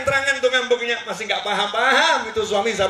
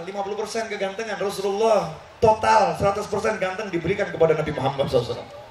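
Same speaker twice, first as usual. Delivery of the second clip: normal talking voice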